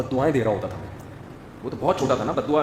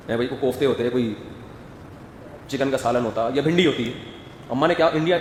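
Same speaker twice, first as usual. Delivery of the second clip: normal talking voice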